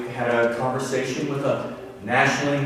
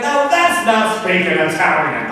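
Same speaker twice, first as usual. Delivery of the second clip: louder mock scolding in a teacher's voice; upset teacher; 5 semitones higher, 9 dB louder than usual. Retelling the teacher scolding the boy Mark, mock upset. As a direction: play the annoyed teacher calling out the boast. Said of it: "She was so upset".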